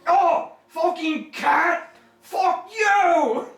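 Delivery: high, loud, slow snarling curse; disgusted curse. Acted. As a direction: spit it at the cat. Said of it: "the disgusting".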